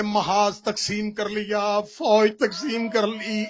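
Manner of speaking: slow, then loud and swelling; slow rising lament. Acted. Husain grieving over telling Abbas, building lament. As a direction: draw it out, then cry it.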